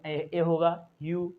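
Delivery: ordinary talk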